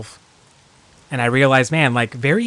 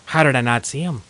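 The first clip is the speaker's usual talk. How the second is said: normal talking voice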